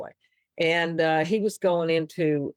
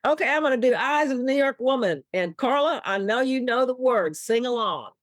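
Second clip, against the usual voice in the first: [name] on stage; 8 semitones higher than usual. Retold or imitated. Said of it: "he said something like".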